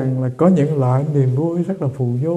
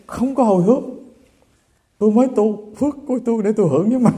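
put-on higher, pouting old lady retort, laughing through it; stubborn old woman arguing; 5 semitones higher, much faster than usual. Old mother argues with temple youths, pouting and higher. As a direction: sulk back like a stubborn grandmother. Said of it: "chẩu mỏ lên, bà cãi lộn".

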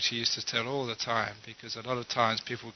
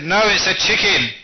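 very loud, high-pitched husband's retort; acted angry outburst at his wife; angry shouting; 6 semitones higher, 10 dB louder than usual. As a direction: shout it down, emphatic and cross. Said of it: "raising his voice even louder and looking very angry".

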